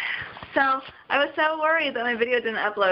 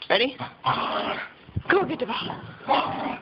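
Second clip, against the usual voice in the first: pet-talk fetch; 3 semitones higher, slower than usual. Real, not acted. Throwing for dog.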